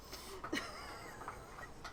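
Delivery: high soft breathy giggle. Real, not acted. Player laughing at own apple joke, high and soft.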